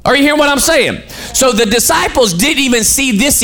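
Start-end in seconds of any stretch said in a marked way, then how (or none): 0.0-1.0 fast, raised, higher call to the room; rousing preacher challenge; preacher's shout. Real, not acted. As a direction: fire it at them, faster and higher. Preacher calls out to congregation.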